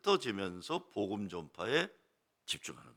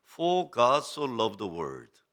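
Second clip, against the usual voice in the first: slow English recital; 2 semitones lower, 4 dB louder, much slower than usual. Shown as a demonstration. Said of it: "reads John 3:16 in English".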